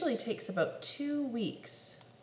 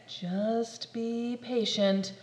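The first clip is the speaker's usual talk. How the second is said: slow reminder; much slower than usual. Acted.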